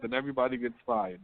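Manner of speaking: ordinary talk, nothing marked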